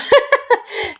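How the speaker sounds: shrieking giggle way up high; high squealing laugh. Real, not acted. Laughing at her own quote.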